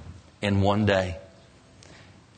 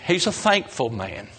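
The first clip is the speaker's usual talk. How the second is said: ordinary talk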